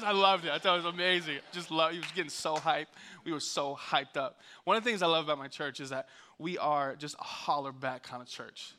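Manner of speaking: ordinary delivery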